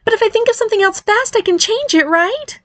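high-pitched desperate pleading; groaning. Acted. Barry begs to change the name, groaning. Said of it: "Barry groaned".

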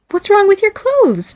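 high, slow, puzzled question; confused. A narrator's reading. Mother puzzled by son's complaint about clothes, confused. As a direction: ask as if baffled.

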